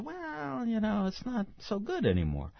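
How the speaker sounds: high, soft, hedging mock publisher; imitated reluctant publisher. Publisher to author selling only twelve, imitated reluctant publisher. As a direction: let the author down with a high, drawn-back "well". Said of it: "they say".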